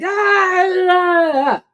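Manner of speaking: high, loud mock rage cries; short furious yells, hammed up; angry shout. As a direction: let out a burst of acted fury. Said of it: "if you're angry".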